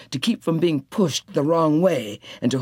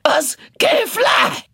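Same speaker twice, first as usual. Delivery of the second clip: shouting; 10 semitones higher, 5 dB louder than usual. A narrator's reading. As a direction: yell to keep them running. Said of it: "Tea Cake shouted".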